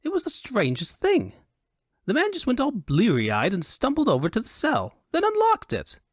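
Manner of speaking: high, swooping foppish voice telling a tale; animated high-pitched fop; high Elvunian fop. A narrator's reading. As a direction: play an amazed dandy recounting something strange. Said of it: "he said in a high Elvunian accent".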